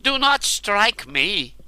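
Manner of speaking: high, loud frightened plea; begging. A narrator's reading. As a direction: beg for your life. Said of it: "he begged".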